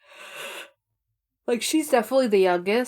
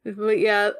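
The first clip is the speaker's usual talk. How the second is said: usual speaking voice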